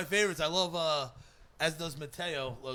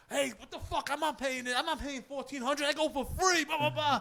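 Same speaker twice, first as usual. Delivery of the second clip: retold yelling; 8 semitones higher than usual. Storyteller re-enacting yelling at the lady over fees, mock indignant. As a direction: imitate an indignant rant at a clerk. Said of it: "screaming at the fence, yelling at the lady".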